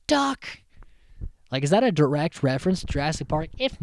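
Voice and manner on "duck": loud, high warning yell; retold warning shout. Acts out a warning cry again.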